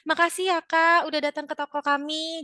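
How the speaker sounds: grateful customer send-off. Shown as a demonstration. Shop staff thanking a visiting customer, friendly and grateful. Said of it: "jangan lupa untuk selalu mengucapkan".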